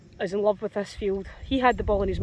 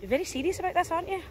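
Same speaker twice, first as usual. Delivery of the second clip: ordinary talk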